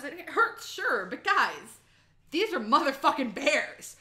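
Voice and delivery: usual speaking voice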